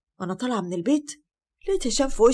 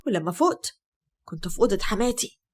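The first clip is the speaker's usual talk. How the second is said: ordinary delivery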